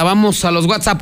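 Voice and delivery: ordinary delivery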